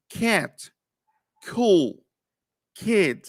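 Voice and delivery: put-on cat, cool, kid with a puff after K; aspirated English K. Shown as a demonstration. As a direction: model each word apart with airy K. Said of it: "estamos aplicando ese principio".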